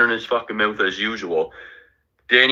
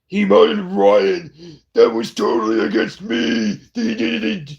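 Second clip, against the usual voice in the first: high whiny mock voice of a complainer; mocking whine; 11 semitones higher than usual. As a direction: mimic him as a squeaky crybaby.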